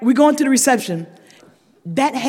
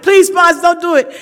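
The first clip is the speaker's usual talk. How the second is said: pleading sponsor; 7 semitones higher, 7 dB louder than usual. Retold or imitated. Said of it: "my sponsor was like".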